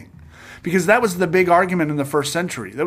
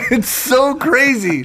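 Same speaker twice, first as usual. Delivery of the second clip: high, drawn-out words through real laughter; laughing amazement; 8 semitones higher, much slower than usual. Real, not acted. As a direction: laugh it out, stretching "so".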